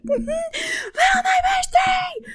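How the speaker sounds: high, slow, loud excited squeal; squealing with excitement. Real, not acted. Thrilled vlogger squeals the title to her viewers. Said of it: "I'm so excited".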